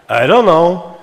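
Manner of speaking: "I don't know" loud and high, rising then falling; tone-pattern demo. Shown as a demonstration. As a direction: slur "I don't know" along one big arched tune. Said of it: "would say this full sentence this way".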